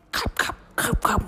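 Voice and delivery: breathy whispered chewing sound; whispered cut cut. Acted. Mouse chewing through the rope in a whisper. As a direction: whisper the gnawing.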